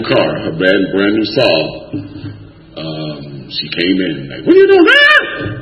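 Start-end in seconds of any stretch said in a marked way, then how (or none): 4.4-5.6 very high, drawn-out shriek; imitated falsetto scream; horrified lady. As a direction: screech it in mock horror. Lady finds him bleeding, screams.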